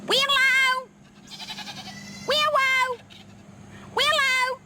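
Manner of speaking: drawn-out farmyard holler; hollering for the goat. Real, not acted. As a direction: call her in from far off. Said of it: "I'm a holler".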